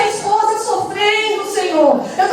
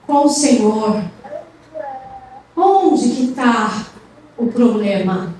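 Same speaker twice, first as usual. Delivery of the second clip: guiding prayer; 6 semitones lower than usual. Real, not acted. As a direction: lead them gently into prayer. Pastor guia a oração. (voz grave e pausada de pastor guiando a oração)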